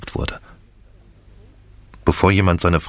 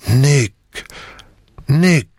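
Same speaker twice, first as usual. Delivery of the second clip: high, falling cry of the name; stunned calling; 8 semitones higher than usual. A narrator's reading. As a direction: call the name in staggering disbelief. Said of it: "und murmelte".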